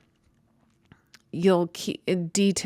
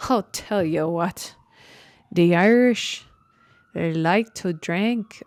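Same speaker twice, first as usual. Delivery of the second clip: bad Irish accent; 5 dB louder than usual. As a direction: put on a hammy, unconvincing Irish accent. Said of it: "that was such a bad Irish accent".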